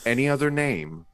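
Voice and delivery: grand recitation. Retold or imitated. Quoting Billy's Shakespeare line to the group in grand mock-theatrical delivery. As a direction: declaim it like a stage actor. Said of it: "I'll quote Billy".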